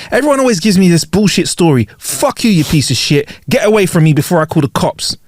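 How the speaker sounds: indignant mock tirade; irate cabbie. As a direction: send the passenger packing as a fed-up cabbie. Rude cabbie rebuffs broke passenger.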